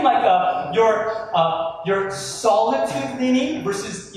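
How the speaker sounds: normal talking voice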